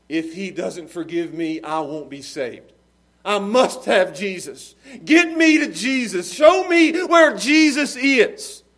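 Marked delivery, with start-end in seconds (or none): 3.2-8.6 building to a loud, high, drawn-out cry; desperate plea. Acted. Desperate convert cries to be taken to Jesus, pleading shout. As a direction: beg urgently, louder with each plea. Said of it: "it was desperation".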